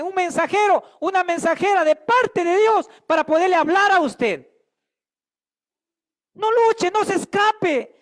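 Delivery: rising preacher's passion; fiery preaching. Acted. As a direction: preach it hard to the congregation. Preaching at full force.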